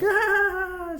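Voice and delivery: shrill attacking shriek at the window; high scream. Retold or imitated. Baby screaming at the car window, high shriek. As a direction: shriek like a monster at the glass.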